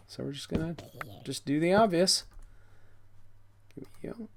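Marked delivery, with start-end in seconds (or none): 3.7-4.3 breathy whisper to the block; whispered aside. Real, not acted. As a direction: whisper it to yourself.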